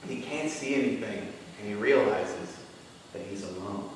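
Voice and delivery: hushed, low and fading; soft, desolate storytelling; somber narration. A narrator's reading. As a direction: let it sink to a near-murmur. Bleak turn in the story.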